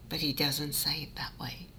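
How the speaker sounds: ordinary talk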